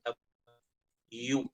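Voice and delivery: ഡബ്ല്യു said slowly with rounded, pushed-out lips; slow lip-rounding demo of ഡബ്ല്യു. Shown as a demonstration. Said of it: "ചുണ്ടിങ്ങനെ വരും അല്ലേ?".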